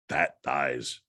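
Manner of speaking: low mimicked voice of a word box; electronic voice box. Ghost gadget speaking up to its owner, low electronic voice-box impression. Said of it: "an electronic voice box".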